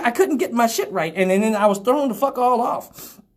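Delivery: ordinary talk, nothing marked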